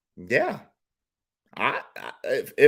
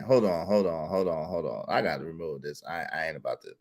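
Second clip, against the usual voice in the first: softer, lower muttered aside; quiet aside; 10 semitones lower, 11 dB quieter than usual. Real, not acted. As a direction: drop your voice as you step away. Pausing stream, aside to himself.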